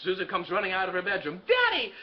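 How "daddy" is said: put-on high-pitched child's delighted cry; hammed-up excited child; little daughter. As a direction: squeal for your father like a small girl. Small daughter runs out.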